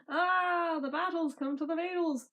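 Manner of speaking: plain reading voice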